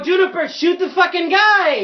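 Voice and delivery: high, loud command to a henchman; shouted order. Acted. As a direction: yell urgently for backup. Player calls order to henchman.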